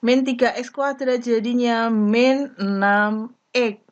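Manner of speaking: usual speaking voice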